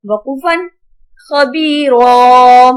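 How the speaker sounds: slow, measured recitation with long-held vowels; Quran spelling drill. Shown as a demonstration. Teacher spells out Arabic words for pupils, drawn-out recitation. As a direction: chant each syllable out for pupils.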